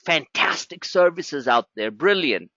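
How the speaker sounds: dry, sneering fantastic and brilliant; sarcastic praise. Real, not acted. Trader mocks rival trading services, dry and sarcastic. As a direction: praise them while meaning the opposite. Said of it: "I say it with sarcasm".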